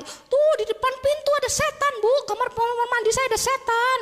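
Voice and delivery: high, wailing panicked cry; mock terrified scream. Nanny dragging her employer to the bathroom door, mock terror. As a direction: shriek it like a scared nanny pointing out danger. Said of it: "pasti teriak-teriak".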